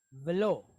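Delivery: "velo" with quick first vowel, long O; pronunciation demo. Shown as a demonstration. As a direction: sound out "velo" with weight on the final O. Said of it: "how are we gonna pronounce this".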